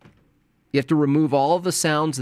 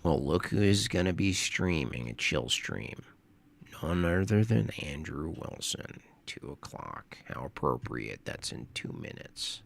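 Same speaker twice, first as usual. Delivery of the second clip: much softer, lower grumbling; muttered mock announcement; mock gloomy aside; 8 semitones lower, 8 dB quieter than usual. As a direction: mutter it like a sulky rival. Mock announcement of rival stream.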